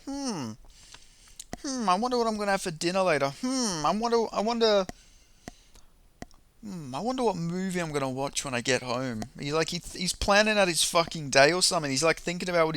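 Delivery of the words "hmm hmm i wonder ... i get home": mock daydreaming referee. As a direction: play a ref lost in thought about dinner. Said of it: "Kerry Hatley's thinking about".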